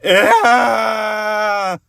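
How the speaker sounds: long high loud terrified scream. Acted. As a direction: scream at the drop below. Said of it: "He looked down and screamed".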